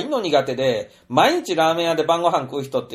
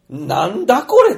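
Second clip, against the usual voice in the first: incredulous; 6 semitones higher, 6 dB louder than usual. Retold or imitated. Speaker's own bewilderment at the silence.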